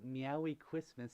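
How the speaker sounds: Christmas said "Kwismas", with W for R; slow "meowy Christmas", R softened to W; slipped pronunciation. Real, not acted. Streamer reading out a pun greeting to Samson, slipped pronunciation. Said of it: "Why am I talking like that?".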